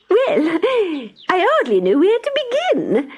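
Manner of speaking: a boastful town mouse. Acted. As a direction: Town Mouse bragging to her country cousin. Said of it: "replied the Town Mouse".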